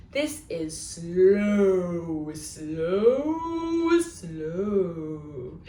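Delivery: slow, stretched long words; very slow drawn out. Shown as a demonstration. Librarian showing slow to children, dragging it out. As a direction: draw out every slow lazily. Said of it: "Should we try that one one more time".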